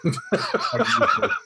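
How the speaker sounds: high burst of voiceless laughter; helpless high laugh; breathless laugh. Real, not acted. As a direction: crack up without much voice. Laughs at missing the sarcasm.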